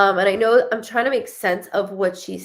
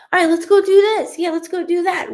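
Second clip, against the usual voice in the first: much higher, louder, fast and bubbly; peppy friend voice; 10 semitones higher, 5 dB louder, faster than usual. Retold or imitated. Medium imitating the hyped-up friend, high and peppy. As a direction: bounce in like the life of the party. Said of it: "the type when she walks in".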